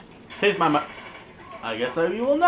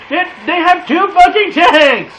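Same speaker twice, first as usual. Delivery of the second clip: loud, much higher furious yell; outraged yell; 12 semitones higher, 12 dB louder than usual. Real, not acted. Gamer rages at the enemy team's two tanks, loud and high. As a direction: explode in outrage.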